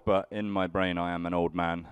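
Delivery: normal talking voice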